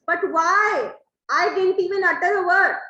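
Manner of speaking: why are you blaming me?; bewildered protest. Acted. Trinculo protests his innocence.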